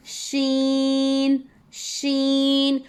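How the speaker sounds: शीन drawn out long, tongue middle to mid palate; Arabic letter sheen. Shown as a demonstration. Teacher demonstrating शीन to her students, slow and drawn out. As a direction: stretch शीन slowly like a recitation drill. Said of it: "तब शीन की अदायगी होती है".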